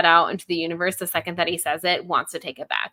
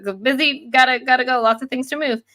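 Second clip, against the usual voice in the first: higher-pitched, bustling mock excitement; excited busyness; 6 semitones higher, faster than usual. Benji telling them he has things to move, excited bustle. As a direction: imitate someone eagerly hurrying off. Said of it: "he's very excited about it".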